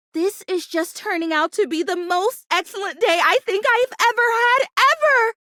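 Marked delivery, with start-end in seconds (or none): 3.6-5.3 excited. Acted. Calls it the best day ever.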